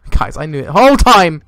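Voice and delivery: loud shout building to the end; shouted bluff. Real, not acted. Player after a jump scare, shouting mock confidence. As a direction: yell it out to the viewers.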